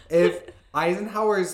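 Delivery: ordinary talk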